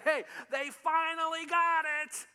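high, loud and slow celebration; high-pitched joyful exclamation, drawn out; jubilant Jesus. Retold or imitated. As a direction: cheer it like throwing a party. Throwing a party saying.

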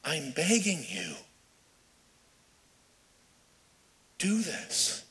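soft, halting, tearful-style plea; brokenhearted begging. Retold or imitated. Paul writing with a tear in his eye, brokenhearted. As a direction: beg quietly, with a long pause before the last words. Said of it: "maybe with a broken heart".

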